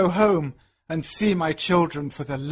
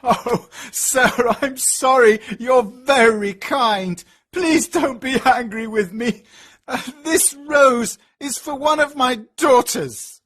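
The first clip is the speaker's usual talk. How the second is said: much higher, louder, frantic begging; pleading in fear; 7 semitones higher, 4 dB louder than usual. A narrator's reading. Terrified father begs the Beast, high frantic pleading. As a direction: beg for mercy, voice up high. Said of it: "starts to cry".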